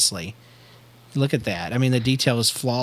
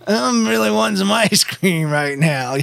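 much higher and slow; stoner voice; 8 semitones higher, slower than usual. Acted. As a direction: drawl it out like a stoned dude. Stoner Doc Wizard voice.

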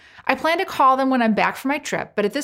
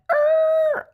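high, sustained imitation of skidding brakes; screeching car brakes; 4 dB louder than usual. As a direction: imitate brakes squealing on one held high note. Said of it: "insert car brakes sliding".

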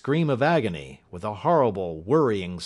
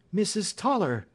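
surprised cry; 10 semitones higher than usual. Acted. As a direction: exclaim the name in surprise, pitched high. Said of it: "cried Miss Hunter".

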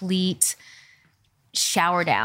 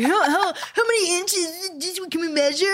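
mocking high voice; 9 semitones higher than usual. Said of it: "It's like".

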